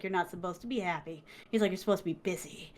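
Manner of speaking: lightly voiced, flat warning; low stern muse. The muse scolding the writer, in a low, soft mock voice.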